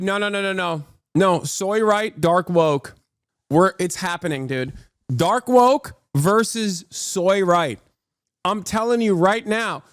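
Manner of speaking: normal talking voice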